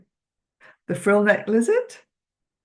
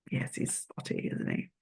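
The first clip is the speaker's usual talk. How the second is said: a hushed aside, much softer; whispered; 6 semitones lower, 12 dB quieter than usual. Real, not acted. To a child, a whispered answer. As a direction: whisper it softly.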